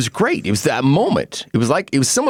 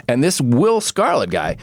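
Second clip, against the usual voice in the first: normal talking voice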